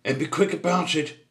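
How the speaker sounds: high, loud, impatient order; snapped. A narrator's reading. Mister Grumpy hurries Percy the butcher, snapping. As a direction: hurry him along crossly. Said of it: "snapped Mister Grumpy".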